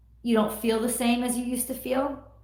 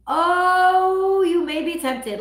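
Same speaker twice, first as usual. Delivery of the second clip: high, louder drawn-out oh; teasing sing-song; 7 semitones higher, 6 dB louder, much slower than usual. Acted. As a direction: tease it playfully. Repeated teasing line.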